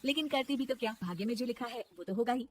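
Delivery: ordinary talk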